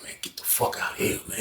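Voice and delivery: hushed whisper, nearly no voice; whispered disbelief. Retold or imitated. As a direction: whisper it so the guards won't hear. Talking through vent during lockdown.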